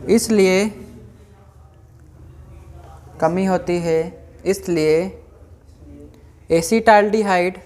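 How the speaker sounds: slow, word-by-word dictation with long pauses; classroom dictation. Acted. Teacher reading out notes for students to write, measured dictation. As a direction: dictate in small, evenly stretched chunks.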